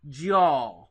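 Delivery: "jaw": do you all run into one loud syllable; Southern contraction. Shown as a demonstration. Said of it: "if I was from the South".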